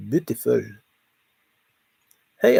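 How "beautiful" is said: ordinary delivery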